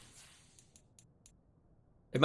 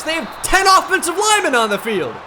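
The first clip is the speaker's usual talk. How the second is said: higher, louder exasperated outburst; excited disbelief; 10 semitones higher, 5 dB louder than usual. Real, not acted. Gamer baffled by the opponent's lineup, exasperated shout.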